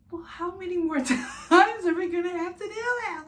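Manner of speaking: high, loud laughing whine; laughing mock whine. Mom retelling labor to viewers, high laughing whine. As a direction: squeal it out through laughter. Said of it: "I'm just like".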